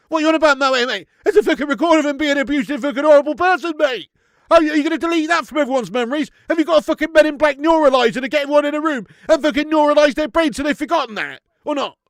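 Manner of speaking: loud, high-pitched indignant tirade; angry rant. Real, not acted. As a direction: tear into the viewer in disbelief. Heated rebuttal of a viewer's comment.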